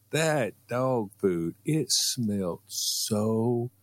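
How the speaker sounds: slow, drawn-out disgust. Real, not acted. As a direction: stretch every word in disgust. Disgusted description of dog food.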